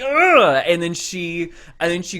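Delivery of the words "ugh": high, loud imitated groan; mock exasperated groan. Retelling the queen's reaction, exaggerated groan.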